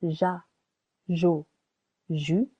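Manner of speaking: soft zh as in measure on "ja, jo, ju"; soft G demonstration. Shown as a demonstration. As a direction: say "ja, jo, ju" with a French zh sound, each separate. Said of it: "To make it sound".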